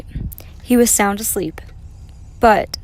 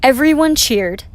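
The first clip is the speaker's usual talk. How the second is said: ordinary delivery, nothing marked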